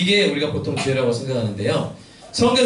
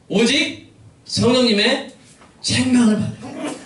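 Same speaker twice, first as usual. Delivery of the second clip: slow, higher preaching emphasis; slow emphatic preaching; 7 semitones higher, slower than usual. Real, not acted. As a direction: lean into each word slowly.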